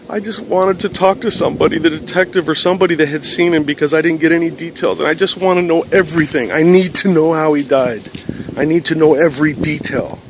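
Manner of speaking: retold desperate plea; imitated crying caller. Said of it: "she's crying very hard".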